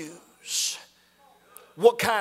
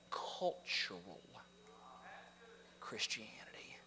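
much lower and softer, almost whispered; hushed whisper; 6 semitones lower, 16 dB quieter than usual. Acted. Preacher warning congregation, hushed whisper. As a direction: let the words drop to a scornful hush. Sermon climax, hushed contrast.

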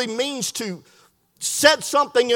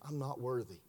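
very low and very soft; hushed confession; 10 semitones lower, 19 dB quieter than usual. Retold or imitated. Preacher retells a man confessing unworthiness, barely audible. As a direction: let the voice sink right down, humbly.

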